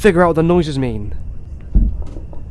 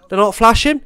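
ordinary talk

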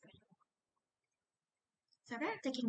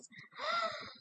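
high shocked gasp; sharp high gasp; 4 dB quieter than usual. Shown as a demonstration. [name] reacting to the principal's office, high gasp. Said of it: "I'm [name]".

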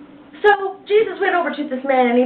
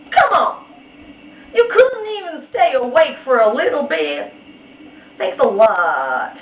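frustrated Jesus; 3 semitones higher, 4 dB louder than usual. Acted. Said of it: "So Jesus was like".

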